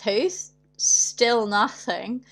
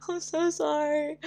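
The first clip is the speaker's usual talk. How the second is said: crying apology; 6 semitones higher, 4 dB quieter, slower than usual. Retold or imitated. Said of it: "I just started crying".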